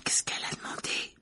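hushed, worried whisper; breathless anxious whisper. A narrator's reading. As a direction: whisper it nervously to classmates. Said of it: "les autres chuchotaient d'un air anxieux".

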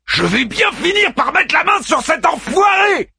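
high angry yell of revenge; furious shout. A narrator's reading. Daeman vowing to catch the bastard, shouting. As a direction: yell the threat at full force. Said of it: "rugit Daeman".

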